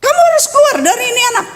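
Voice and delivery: high-pitched, firm refusal; retold stern command. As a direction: re-enact ordering a spirit out, pitch jumping up.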